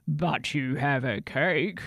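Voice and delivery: slow, hungry troll voice; greedy troll. Acted. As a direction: eye the cake like a troll. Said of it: "The troll licked his lips".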